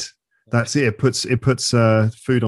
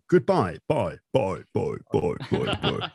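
fading goodbye; 4 semitones lower than usual. Shown as a demonstration. Showing how episodes end, goodbye trailing away. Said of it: "like that".

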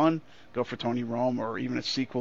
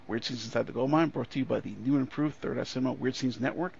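ordinary talk, nothing marked

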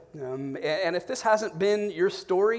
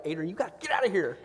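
quick, firm order to his wife; urgent instruction; 3 dB quieter, faster than usual. Retold or imitated. Dad telling his wife to leave the pool, quick firm order. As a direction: hurry the wife out, briskly. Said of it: "I'm like".

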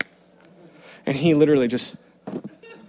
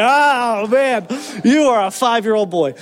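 higher, louder, fond exasperation; amused laugh; 8 semitones higher, 4 dB louder than usual. Retold or imitated. Father amused by his five-year-old asking for grace, laughing. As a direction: laugh at the little boy's question.

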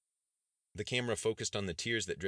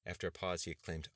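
ordinary delivery, nothing marked

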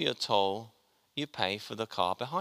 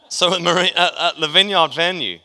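loud correction; 7 semitones higher, 11 dB louder than usual. Real, not acted. Correcting the venue to congregation.

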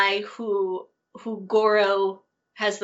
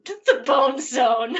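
slow, louder, higher mock title; playfully drawn out; 4 semitones higher, 5 dB louder, slower than usual. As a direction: stretch the silly name out with relish. Savouring a silly stage name.

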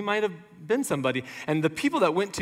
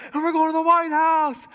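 loud, higher rally shout; imitating a pumped-up candidate; Howard Dean rally cry; 11 semitones higher, 5 dB louder than usual. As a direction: fire up the crowd like a candidate. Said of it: "And he goes".

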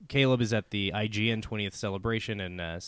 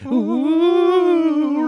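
high, loud ghostly oooh; spooky ghost wail; 11 dB louder than usual. Shown as a demonstration. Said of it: "other scary sound effects".

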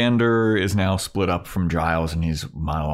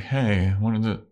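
mock awkward guy, higher pitched; tentative, halting opener; 4 semitones higher than usual. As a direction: stumble into small talk, awkwardly. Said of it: "he's all awkward".

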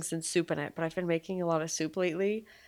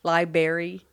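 ordinary talk, nothing marked

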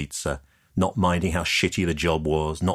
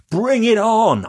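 loud, much higher defiant shout; called out; 7 dB louder, slower than usual. Acted. Developer shouting after Leah as she walks off, loud defiant call. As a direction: yell it after her, full of defiance. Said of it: "I called".

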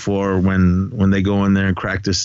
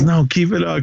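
higher, playful imitation of her insisting; a hint of a coy woman's voice; mock insistent woman; 7 semitones higher than usual. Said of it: "and she goes".

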